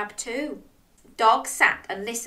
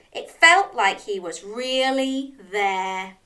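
ordinary delivery

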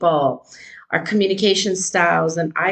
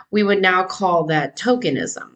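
ordinary talk

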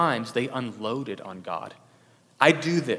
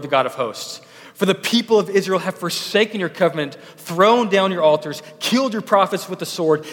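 loud and higher, a desperate protest; Elijah's loud complaint; 4 semitones higher, 6 dB louder than usual. Acted. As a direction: pour out Elijah's grievance loudly, slowing on alone.

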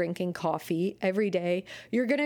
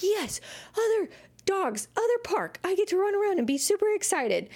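high, fast, thrilled dog voice; excited dog; 11 semitones higher than usual. Pretend. Dog in the car thrilled about the park, high and fast. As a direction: bounce it up like a pumped puppy.

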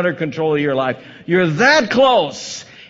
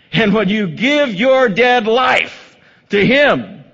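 loud, higher, slowed preacher build; preaching at full force; 5 semitones higher, 4 dB louder than usual. Acted. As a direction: hammer each line of the story home.

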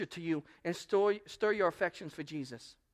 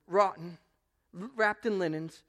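usual speaking voice

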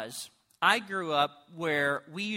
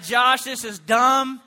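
bored, blunt God; 4 semitones higher, 7 dB louder than usual. Acted. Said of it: "God was bored. He's like".

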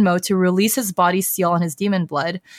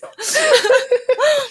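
high-pitched laugh. Real, not acted. Laughing at alphabet soup.

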